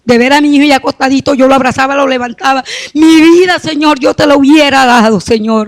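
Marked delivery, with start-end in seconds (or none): 2.9-5.7 anguished cry to God. Real, not acted. Grieving mother calling out to God over her son, anguished shout. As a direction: voice rising into a real grieving shout, slowing at the end.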